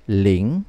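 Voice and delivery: Ling dipping and rising in pitch; number zero reading. Shown as a demonstration. Teacher reading zero to learners, Ling with rising tone. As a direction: slide Ling upward to the end. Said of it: "we'll read it as".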